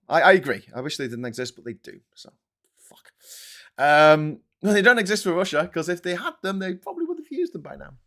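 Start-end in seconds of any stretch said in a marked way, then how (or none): none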